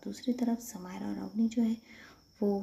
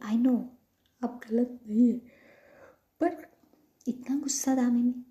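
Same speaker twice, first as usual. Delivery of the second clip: louder, higher pleading objection; raised-voice appeal; earnest remonstrating; 5 semitones higher, 10 dB louder than usual. Acted. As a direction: push back emphatically but on their side. Acted remonstrating with an angry partner.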